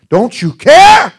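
high, loud, drawn-out plea; alarmed outcry. Acted. Disciples crying out in the rocking boat, alarmed and loud. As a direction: cry out over a storm, stretching the last word.

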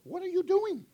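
high-pitched startled question, softly played; mock bewilderment. Preacher asking his talking hands, high mock disbelief. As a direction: squeal it as if baffled by your own body.

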